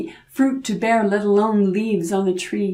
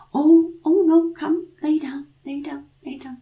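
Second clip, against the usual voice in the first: high voice fading to whisper; soothing whisper; 9 semitones higher than usual. A narrator's reading. Grandmother whispering the devil back to sleep. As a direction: hush him back to sleep.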